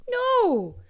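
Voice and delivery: sudden high, loud NO; emphatic no. Pretend. Host playfully rallies Apple listeners, loud mock shout. Mock rivalry with Spotify fans.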